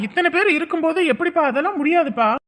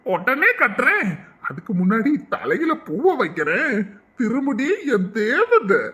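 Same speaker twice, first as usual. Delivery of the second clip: tender groom; 5 semitones lower, much slower than usual. Acted. Groom lovingly offers to tie thali.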